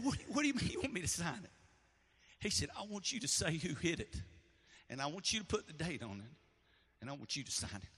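soft, emotional retelling; choked-up memory; tearful dialogue. Real, not acted. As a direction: speak through held-back tears.

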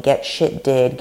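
ordinary talk

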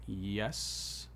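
hushed, low, hesitant admission; imitating an awkward, subdued yes; awkward reply. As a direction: answer sheepishly, drawing back. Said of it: "looks probably awkwardly at Jesus".

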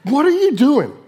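high, loud mock outrage; acted-out angry yell; angry retort. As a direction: snap back after being startled. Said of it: "and I'll get angry".

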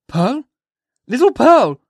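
calling out loud and high into the night; crying out. Acted. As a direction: minister crying out for the child in the dark, high loud call. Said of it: "cried he".

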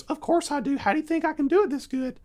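put-on high, quick boastful kid; young son's voice. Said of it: "He goes".

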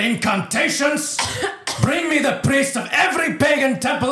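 high-pitched raging tirade; furious ruler. Acted. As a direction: thunder desperate orders at useless servants. Pagan governor rages at failed healers.

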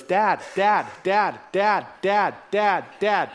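put-on insistent little kid calling; pestering child. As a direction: nag Dad over and over. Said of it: "my four-year-old ... goes".

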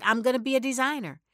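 quick, bright, determined; excited declaration. Retold or imitated. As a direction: announce it with fresh resolve. Declaring new dream, quoted.

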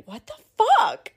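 high, shocked what the fuck; shocked outburst. Retold or imitated. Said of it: "I was like".

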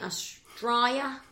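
put-on slow, drawn-out Australian accent.

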